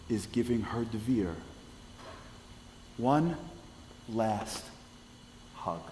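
one, last, hug, each word set apart by long pauses; slow dramatic pauses. Real, not acted. Storyteller landing the final hug, slowly with pauses. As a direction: let each word land alone.

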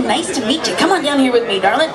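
ordinary talk

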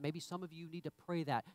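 ordinary talk

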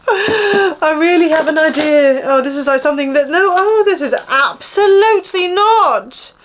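building to a fed-up absolutely not; exasperated. Real, not acted. Unhappy subscriber rejecting an ugly item, high and exasperated. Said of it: "I'm annoyed".

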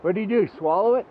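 ordinary talk

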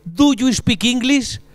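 slow, careful English question; slow tourist question. Retold or imitated. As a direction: ask it slowly like a lost tourist. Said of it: "un extranjero ... que te dice".

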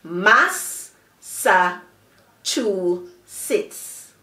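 "mas, sa, chu, sits" as four separate beats; slowly broken-up teacher's "mas, sa, chu, sits"; syllable by syllable. Shown as a demonstration. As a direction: pause after each of "mas, sa, chu, sits". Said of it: "has four syllables".

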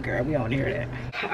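put-on low, quiet "Girl, we don't wanna hear that"; imagined viewers. Vlogger voicing bored viewers telling her to stop rambling. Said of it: "y'all probably like".

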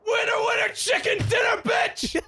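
high, loud victory chant; triumphant shout. Real, not acted. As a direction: holler it in triumph. Celebrating winning the fight.

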